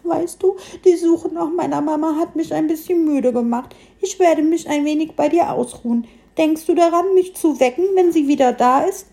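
high little piglet voice, asking carefully; cautious sleepy piglet. A narrator's reading. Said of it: "fragte es vorsichtshalber noch mal nach".

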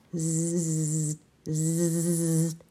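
quiet zzz buzzing of a ladybird; hushed insect buzz; soft buzzing. A narrator's reading. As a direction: buzz softly like a small insect. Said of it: "summt es leise durch die Luft".

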